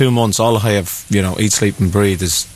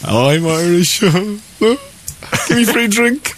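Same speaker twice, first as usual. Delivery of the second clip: high, slow mock Irish voice; Irish accent; 11 semitones higher, much slower than usual. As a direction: put on a hammy Irish accent. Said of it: "he pretends he's Irish when he goes over".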